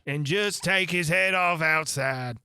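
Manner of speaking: loud, slow royal order; imperious command. Acted. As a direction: bark the order like a queen. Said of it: "the Queen added".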